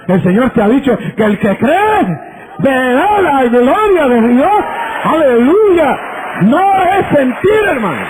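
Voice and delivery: impassioned pulpit shout; preaching at full force. Acted. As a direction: build to a fiery hallelujah. Preaching climax.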